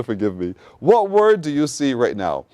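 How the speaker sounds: ordinary delivery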